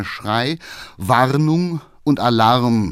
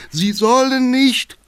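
high, loud, drawn-out cry; shouting; 14 semitones higher, 5 dB louder than usual. Acted. Councillor shouts from behind as the horse bolts. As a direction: yell from the back seat. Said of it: "klang von hinten die Stimme".

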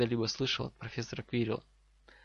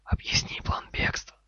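stern, urgent whisper; commanding snake hiss. Acted. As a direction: hiss it as an order, voiceless. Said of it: "скомандовала змея".

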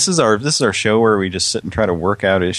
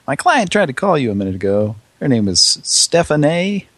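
prank caller voice; 7 semitones higher, 3 dB louder than usual. Pretend.

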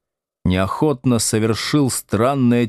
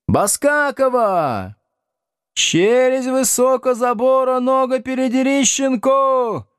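high-pitched rowdy classroom yells; class shouting. A narrator's reading. Pupils shout names to confuse the teacher, yelling. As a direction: pipe up like pupils shouting over each other. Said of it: "закричали Слушкину".

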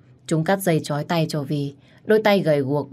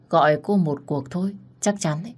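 plain reading voice

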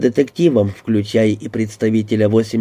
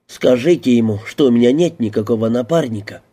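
ordinary delivery

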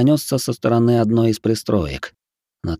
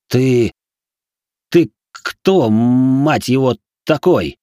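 hoarse, shaken, louder question; 3 semitones higher, 4 dB louder, much slower than usual. Acted. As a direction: rasp it out in shock. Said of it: "севшим голосом уточнил я".

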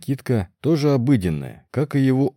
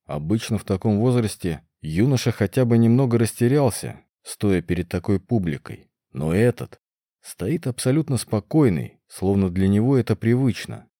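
plain reading voice

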